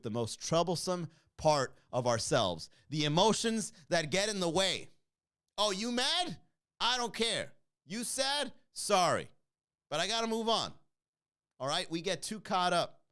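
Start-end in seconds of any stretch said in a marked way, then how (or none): 5.6-10.7 taunting. Acted. Taunting emotional people.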